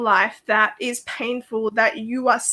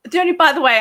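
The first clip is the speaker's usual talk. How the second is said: ordinary delivery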